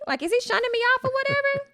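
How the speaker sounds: high, loud, put-out question; her own indignant question. Retold or imitated. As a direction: ask high and offended, mock-hurt. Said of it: "So I'm like".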